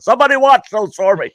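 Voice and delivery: high, loud and fast call to the stands; shouting while gearing up for a fight; mock loud order; retold shout. Throwing glasses before fight.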